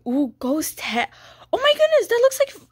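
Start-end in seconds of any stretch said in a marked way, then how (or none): 1.5-2.7 high, loud gasp of delight; thrilled to spot the lookalike; excited squeal. Real, not acted. Spotting the ghost head item, high and louder.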